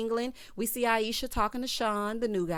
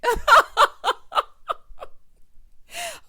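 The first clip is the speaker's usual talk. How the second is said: a big shrieking burst of laughter; loud high laugh; 11 dB louder than usual. Real, not acted. Laughing at the cast member's battlefield line, loud and high.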